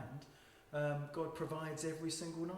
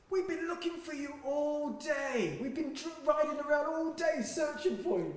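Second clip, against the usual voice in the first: high, eager, slow, with a laugh; eager young bikers; 10 semitones higher, 4 dB louder than usual. Retold or imitated. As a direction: play the thrilled bikers who finally found them. Eager bikers who found them.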